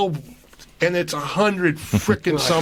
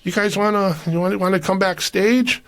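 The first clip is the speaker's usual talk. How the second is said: high, bright inviting voice; friendly Guinness rep; 8 semitones higher than usual. Retold or imitated. Guinness rep inviting the group backstage, upbeat. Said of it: "came by and said".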